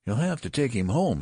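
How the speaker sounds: emphatic nurse's order; insistent nurse. A narrator's reading. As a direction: insist firmly. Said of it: "insisted the nurse".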